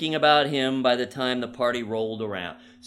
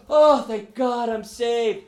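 relieved cry; 7 semitones higher, 7 dB louder, slower than usual. Retold or imitated. Relief after choking.

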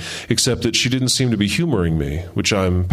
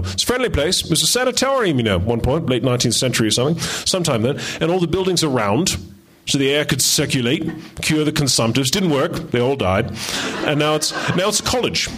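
put-on Ronnie's Australian accent, higher and chatty; breezy Australian accent telling the history; 4 semitones higher than usual. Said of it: "that's my Australian accent".

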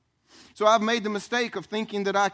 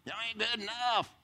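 mock evil preacher; 3 semitones higher, 6 dB quieter than usual. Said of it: "one of those evil preachers".

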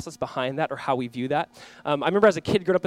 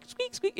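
put-on squeaky baby legs; 8 dB quieter than usual. Father imitating the baby crawling, high mock squeaks.

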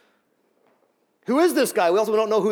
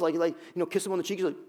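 ordinary talk

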